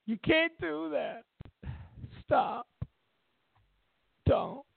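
a shorter rerun of the squealing protester; mocking lefties. Vlogger mocks lefties telling Trump to stop, high whine.

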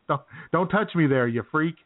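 ordinary delivery

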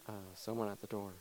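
ordinary talk, nothing marked

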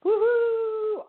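high, sustained whoop; celebratory high woohoo; excited cheer. Real, not acted. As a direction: cheer in a long, high-pitched hoot. Host cheers guest being ready.

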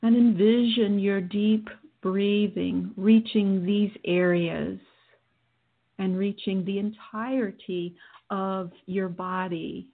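guided meditation. Acted. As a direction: guide them through calmly.

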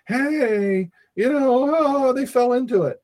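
high, sneering mock tone. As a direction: imitate a gloating enemy. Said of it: "they say".

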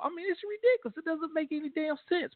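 exasperated. Real, not acted. Ranting about a bad lineman.

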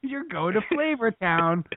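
hammed-up food-show host; Guy Fieri. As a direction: sell it high like a TV showman. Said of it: "Guy Fieri's thirty dollar Burgers".

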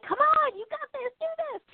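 high cheerleading coo; cheering on an animal. Pretend. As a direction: coax a pet along, high and sweet.

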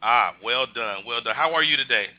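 usual speaking voice

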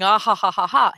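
mustache twirling villain cackle; hammy evil villain laugh. Acted. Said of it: "twisting his mustache and saying".

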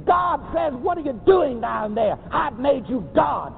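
usual speaking voice

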